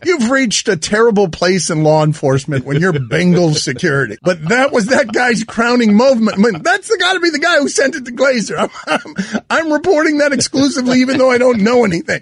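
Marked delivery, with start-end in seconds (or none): 4.9-12.2 laughing riff. Real, not acted. Laughing mock reporter bit.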